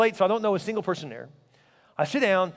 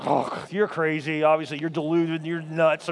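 low, slow scoff; eye-rolling dismissal; 6 semitones lower, slower than usual. Retold or imitated. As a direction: imitate a woman brushing off a compliment, pitched well down. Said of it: "every woman rolls her eyes and goes".

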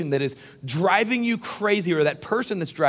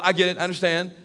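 ordinary talk, nothing marked

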